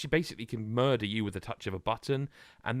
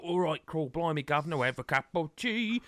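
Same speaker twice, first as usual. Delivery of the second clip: a hint of an exaggerated cockney; mock cockney; 4 semitones higher than usual. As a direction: lightly send up a stage Londoner. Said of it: "they don't all go, like".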